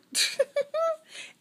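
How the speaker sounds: a burst of high embarrassed laughter; sheepish giggle at herself. Real, not acted. Laughs at having to refilm.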